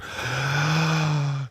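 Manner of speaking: a big hammed-up gasp, pitched high; mock shocked gasp. Teasing his co-host, a pretend offended gasp. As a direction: gasp like you've been insulted, half joking.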